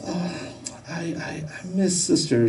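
small, high, soft mock whimper; whimpering cry. Three-year-old missing her sister, imitated whimpering cry. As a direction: imitate a little child starting to cry. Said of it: "the whimpering cry".

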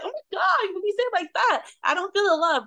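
high, dramatic disbelief; high mock shock. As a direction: gasp it out, scandalised. Mock reaction to harsh words.

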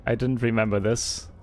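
usual speaking voice

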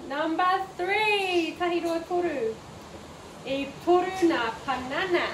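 usual speaking voice

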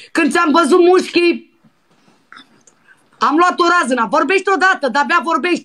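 hammed-up needy shrieking to make him talk; mock impatient demand. Prank caller pesters the silent man to answer, high and hammed-up impatient.

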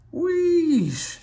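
high loud steam wheesh; unhappy engine wheesh. A narrator's reading. Henry unhappy about the tunnel, high loud steam wheesh. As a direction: let out a miserable hiss of steam. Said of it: "said Henry unhappily".

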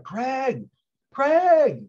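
high, loud call across a room. Retold or imitated. Young woman calling across the theater, high loud yell. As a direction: call his name from far away to catch his eye. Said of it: "yelling at me from the side of the theater".

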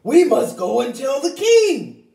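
loud, urgent hen. Acted. Henny Penny urging Turkey Lurkey to come to the king. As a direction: hurry them along.

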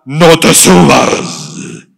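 mock thunderous voice, very loud and very slow; booming mock voice. Shown as a demonstration. Preacher mocks a thundering God voice, drawn-out shout. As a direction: boom it out like a voice from the sky, to show how God does not speak. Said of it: "no es que Dios habla".

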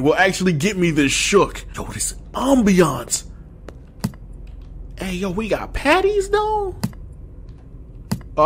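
usual speaking voice

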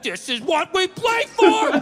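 high, slow, hyped locker-room cry; pumped-up football yell. Retold or imitated. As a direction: roar it like a player walking out of the tunnel. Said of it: "walking through the tunnel at the Rose Bowl".

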